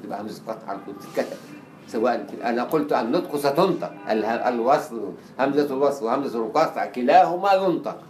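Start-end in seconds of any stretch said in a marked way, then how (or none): none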